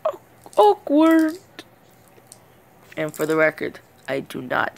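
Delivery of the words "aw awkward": ordinary talk, nothing marked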